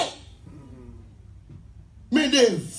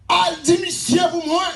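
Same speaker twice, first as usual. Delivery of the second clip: raised, shouting preacher's cadence with a higher voice; preaching at full force; 8 semitones higher, 3 dB louder than usual. Acted. Preacher rousing the congregation with the story, full-force preaching. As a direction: preach it out to the whole church.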